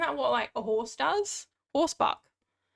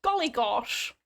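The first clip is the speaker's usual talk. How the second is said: put-on old-fashioned exclamation; slower than usual. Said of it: "So I just".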